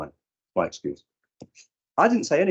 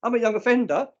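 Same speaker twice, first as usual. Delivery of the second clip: put-on much higher, cocky young offender; imitating a young offender; 13 semitones higher, 5 dB louder than usual. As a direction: voice the kid owning the label. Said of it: "If they go".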